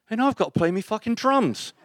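very high English madman voice; imitating an indignant English drummer, pitch shooting up. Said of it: "He goes".

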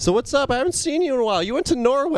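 mock morning-show host. Morning talk, morning news.

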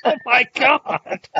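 laughing disbelief, high and slow; can barely get the words out for laughing. Real, not acted. Laughing at a friend's surprise teaching certificate.

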